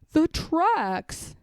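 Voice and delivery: slow, lightly voiced bear; a slow, thoughtful Pooh. A narrator's reading. Pooh answers Piglet, slow and ponderous.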